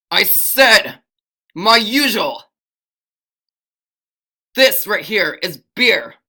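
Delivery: loud, high, acted rage; hammed-up angry customer's yell; furious. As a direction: shout each phrase at the bartender. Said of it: "he was just furious".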